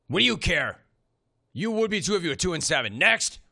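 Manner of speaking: mock-testy comeback, punching the last word; mimicked curt retort. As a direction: act out the coach's dismissive answer. Host re-enacts the coach's curt rant.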